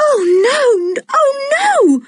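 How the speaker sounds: very high, crying out in panic; panicked cry. A narrator's reading. Girl crying out in panic for her fallen dog. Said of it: "cried George in panic".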